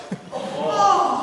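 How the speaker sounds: a short high laugh of disbelief; real laugh. Real, not acted. Pastor laughing at the three-week wait to the church, disbelieving laugh. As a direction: laugh at how absurd it is.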